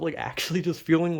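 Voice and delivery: ordinary talk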